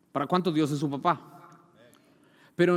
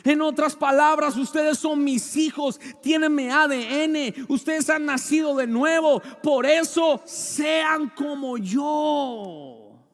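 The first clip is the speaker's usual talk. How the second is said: loud, slow preaching; forceful preaching; 10 semitones higher, 7 dB louder, slower than usual. Acted. Preacher exhorting the church, slow and loud. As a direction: drive each word home to the congregation.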